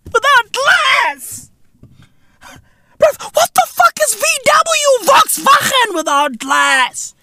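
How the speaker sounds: high-pitched mock outrage; high shrill rant. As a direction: squeal it out in a high ranting voice. Ranting, voice jumps high.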